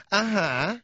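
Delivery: high, unimpressed uh-huh; sarcastic uh-huh. Retold or imitated. Hostess humoring the caller with a sarcastic uh-huh. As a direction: drag it out, not buying it.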